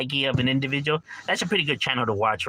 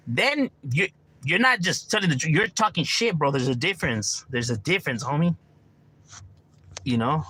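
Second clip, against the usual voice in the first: ordinary talk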